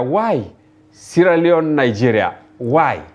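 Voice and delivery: usual speaking voice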